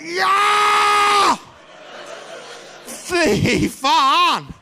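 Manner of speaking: very high, loud imitation of a furious wife; hammed-up shrieking outburst; mock scream. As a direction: screech it and stretch the words out.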